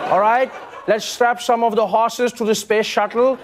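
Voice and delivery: hammed-up Pennsylvania Dutch accent, rising.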